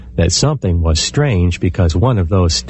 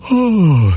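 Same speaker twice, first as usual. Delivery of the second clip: groggy waking yawn; yawning sigh; 8 semitones higher than usual. Acted. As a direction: stretch out a sleepy yawn-sigh.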